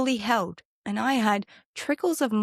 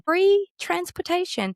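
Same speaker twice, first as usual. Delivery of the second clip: amazed; 10 semitones higher, 3 dB louder than usual. Real, not acted. Amazed at tiny home deal.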